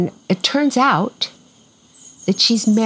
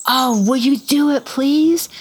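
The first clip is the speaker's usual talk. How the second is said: higher-pitched retold plea, rising on please; imitated pleading; 6 semitones higher than usual. Woman on the phone begging a favour, imitated pleading. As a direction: plead eagerly as the caller, imitating her.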